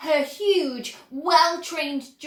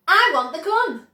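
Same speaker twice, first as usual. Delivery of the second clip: obstinate; 6 semitones higher, 9 dB louder than usual. A narrator's reading. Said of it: "Violet said obstinately".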